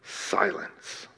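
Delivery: hushed, breathy whisper. Shown as a demonstration. Preacher evoking Jesus' silence with a whisper. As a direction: let the word fall into silence. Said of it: "But Jesus did not answer her a word".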